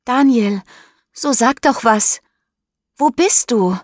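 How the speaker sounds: high, loud call into the night. Acted. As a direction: call for him across the lake. Mischa ruft nach Daniel. (laut rufend in die Nacht)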